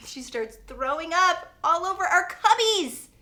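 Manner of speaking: plain reading voice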